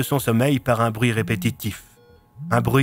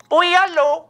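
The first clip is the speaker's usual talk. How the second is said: put-on much higher, squeaky old woman; 5 dB louder than usual. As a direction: pitch it way up like an old lady. Old woman answers phone.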